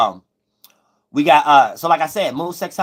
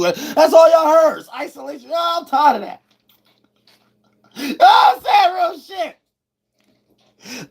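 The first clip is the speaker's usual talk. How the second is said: high strained outburst; 12 semitones higher, slower than usual. Real, not acted. Streamer vents to chat about hearing only isolation, high-pitched.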